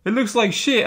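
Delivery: high, rushed mock whine of a complaining fan; whiny complainer mock. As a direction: sneer it like a whining fan. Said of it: "they bitch, moan, and complain".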